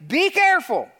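high, punched caution; urgent warning call; emphatic warning. Retold or imitated. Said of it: "Like, it's emphatic".

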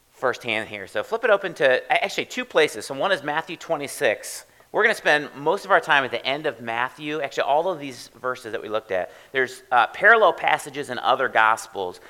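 loud, high, driving call to the congregation; rally them to flip their Bibles open; preaching at full force. Real, not acted. Preacher urging the congregation to open Bibles, loud and high.

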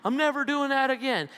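high, pleading promise; mock solemn vow. Preacher imitating himself swearing off the valley. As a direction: swear it off in a whiny voice.

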